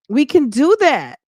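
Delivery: high, punchy and sassy; sassy emphasis. Real, not acted. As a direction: say it with confident sass. Repeat, emphatic.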